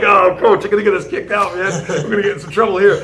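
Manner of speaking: high, loud, laughing through the words; laughing plea. Retold or imitated. Student pleading with his coach in a restaurant, laughing. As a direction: beg the coach to stop while cracking up. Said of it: "I was like".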